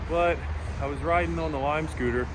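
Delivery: panting after a jog; winded, gasping between words; out of breath. Real, not acted. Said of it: "Sorry, I'm a little winded".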